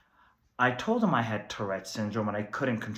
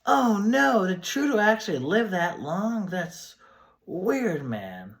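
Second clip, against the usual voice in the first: high-pitched, startled hippie voice; spaced-out flower child taken aback; dismayed hippie; 8 semitones higher, slower than usual. Acted. Plays groovy time-traveling guest.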